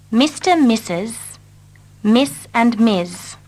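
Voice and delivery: ordinary talk, nothing marked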